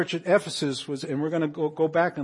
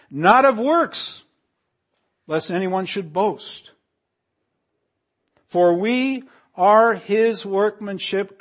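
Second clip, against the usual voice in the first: loud, higher, slow scripture proclamation; fervent preaching; 4 semitones higher, 7 dB louder, much slower than usual. Acted. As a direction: proclaim every phrase from the pulpit. Preaching scripture at full force.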